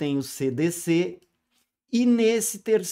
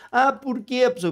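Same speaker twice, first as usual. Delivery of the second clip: a hint of a surprised pupil, higher; mock student question; 8 semitones higher, 3 dB louder, faster than usual. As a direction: ask like a puzzled student. (aluno surpreso, de leve)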